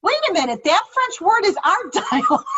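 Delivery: high-pitched retold disbelief, breaking into laughter; shocked. Recalling the French words found in the dialect, shocked. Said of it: "I was shocked. I'm like".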